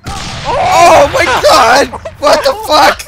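slow, loud, high disbelief; shocked shout. Real, not acted. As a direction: drag out the shock. Shocked by game event.

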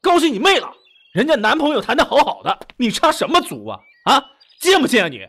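high, loud enraged yelling; furious outburst. Acted. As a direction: scream at them to get out. Furious quarrel, orders friend out.

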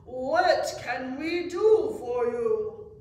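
loud, slow, formal frog leader; chief frog speaking up. A narrator's reading. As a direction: address the visitor slowly and loudly, with importance. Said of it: "finally the chief frog spoke up".

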